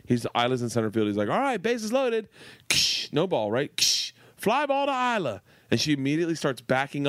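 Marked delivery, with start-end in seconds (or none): 1.3-2.3 higher, announcing the play; coach calling out. Retold or imitated. Coach announcing bases loaded to the team, called out higher. 3.8-5.4 higher, called out with a hit noise; coach calling out. Retold or imitated. Coach hitting an imaginary fly ball to Isla, calling higher.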